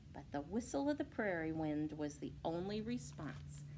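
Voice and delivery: usual speaking voice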